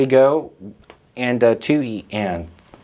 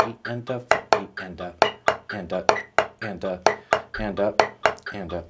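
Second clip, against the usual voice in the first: soft, rhythmic counting over the drumming; steady metronomic count, fading softer; counting along; 3 semitones lower, 5 dB quieter than usual. Shown as a demonstration. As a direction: count the subdivisions evenly under your breath. Counts aloud while drumming.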